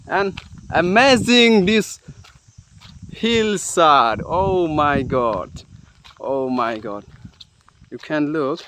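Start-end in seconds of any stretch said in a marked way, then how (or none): none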